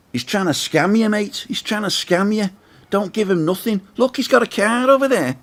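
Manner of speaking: high-pitched imitation of a Manchester Liverpool accent. Stranger at the next table warning the speaker off, imitated Manchester accent. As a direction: mimic the northern English couple in a Manchester accent, pitched well up. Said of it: "They had sort of Manchester, Liverpool-y type accents".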